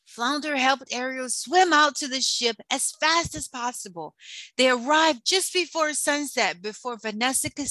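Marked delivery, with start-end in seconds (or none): none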